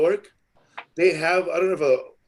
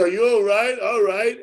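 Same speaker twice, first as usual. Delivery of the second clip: higher-pitched retold concern; retold concerned check; 7 semitones higher than usual. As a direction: mimic someone checking on you. Retells her worried check.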